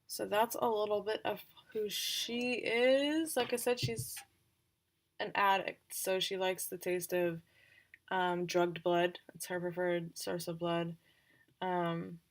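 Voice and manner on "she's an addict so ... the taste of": ordinary talk, nothing marked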